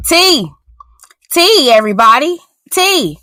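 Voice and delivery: high, pleading call of his name; exasperated. Real, not acted. Host exasperated at T I, calling his name. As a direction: call his name like you give up on him.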